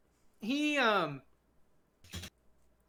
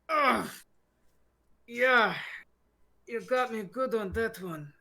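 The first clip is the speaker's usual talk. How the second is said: pained grunt; 5 semitones higher than usual. Acted. Punched man rubbing his jaw.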